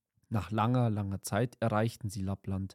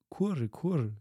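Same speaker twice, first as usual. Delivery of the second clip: high, cooing "Kurre, kurre" like wood pigeons; cooing doves; 5 semitones higher than usual. A narrator's reading. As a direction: coo it like a dove, higher. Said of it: "Da sagten die Waldtauben".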